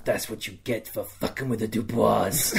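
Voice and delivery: low, soft, menacing gloat; gloating taunt. Retold or imitated. Noble leaning over a dead enemy, gloating quietly. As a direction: lean in and gloat under your breath.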